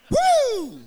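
high, loud "woo"; excited whoop. Retold or imitated. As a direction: whoop like you're on a joyride. Re-enacting joyride off-roading.

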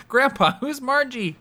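put-on very high, teasing child's question; high mock-innocent voice; mock grandchild. Acts grandchild asking grandpa.

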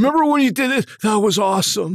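high, starstruck fan voice; gushing fan. Retold or imitated. An actor mocking himself fawning over his co-star. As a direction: gush over the old sketch like a fan. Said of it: "I didn't wanna".

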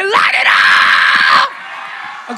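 Light it up stretched very slow into a loud crying shout; drawn-out cry. Shown as a demonstration. Preacher demanding a big reaction, long crying shout. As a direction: wail the words out long and loud. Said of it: "They're gonna go crazy when you say this".

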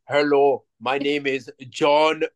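slow, hammed-up Arnold-style Austrian accent; badly hide a thick Austrian accent, word by word; mock Austrian accent. Podcaster mocks Arnold introducing himself as American, heavy Austrian accent. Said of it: "can't even do the accent".

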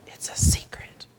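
breathy secret whisper, unvoiced. A narrator's reading. Boy whispering a secret to grandpa. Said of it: "whispered Benny".

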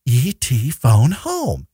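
slow, drawn-out alien croak of the line; imitating the famous line. Friend mimics the movie line for the podcast, slowly. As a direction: stretch each word out slowly like the movie. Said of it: "Drew Barrymore goes".

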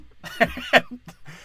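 loud high cackle; cackling at the Moses punchline; high cackling laugh. Real, not acted. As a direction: let a shrieking laugh escape. Laughs at own joke.

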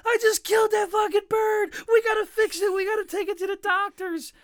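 high, loud, frantic sobbing plea; a panicked kid begging to save the bird; wailing at mom in a high panic; crying freak-out. Retold or imitated. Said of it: "I started fucking crying and freaking out".

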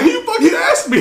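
very high, loud laughing protest; mock exasperated. Recruit to baiting sergeant, mock exasperation. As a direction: squawk it in comic indignation.

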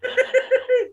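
high squealing laugh; burst of laughter. Real, not acted. Teller laughing at his terrible joke, high squeal. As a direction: crack up at your own joke.

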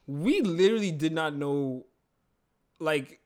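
ordinary talk

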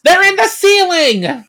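high, loud cry of discovery; sudden realization. Acted. Queen spots the Rangers hiding overhead, shouting. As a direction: shout it the instant it dawns.

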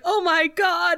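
high-pitched imitated gasp of dismay; playfully acted astonishment; mock shock. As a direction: pitch it up as a scandalized reaction. Acting Willow's reaction to the laptop.

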